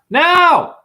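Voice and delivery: "Now!" barked out high and loud; shout. Acted. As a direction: jolt the listeners awake with it. Sudden call for attention.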